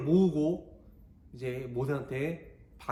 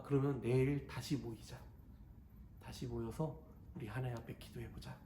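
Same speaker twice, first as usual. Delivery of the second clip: softer, lower, gentle proposal; quiet Moses; 5 semitones lower, 13 dB quieter than usual. Acted. Moses proposing prayer to the rebels, soft and low. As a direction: invite them to pray, hushed. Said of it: "그렇게 이야기하는 거예요".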